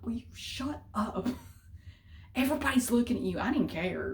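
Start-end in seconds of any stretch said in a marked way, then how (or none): 0.0-1.3 urgent whispered scold; mock-whispered mother's telling-off; mother's hushed scolding. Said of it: "She kept telling me to shut up". 2.4-3.4 mother's hurried scold. Retold or imitated. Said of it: "She kept telling me to shut up".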